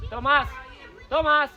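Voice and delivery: loud repeated shout of a name; calling out. Real, not acted. Coach gets Thomas's attention, calling out. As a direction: holler until the player hears.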